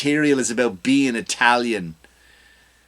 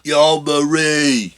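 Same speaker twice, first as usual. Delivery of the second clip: slow, louder drawn-out Jersey Italian yell; mock Jersey Italian call; 4 dB louder, much slower than usual. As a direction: call out to Marie like a Jersey guy. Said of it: "You go".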